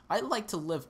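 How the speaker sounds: high and loud mock wish; excited gush. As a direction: gush about living there, way up high. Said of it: "and I'm just like".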